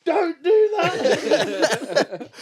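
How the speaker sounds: drawn-out high, loud, laughing warning; very slow laughing no; laughing scold. Retold or imitated. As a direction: try to scold but crack up. Laughing mum scolding child.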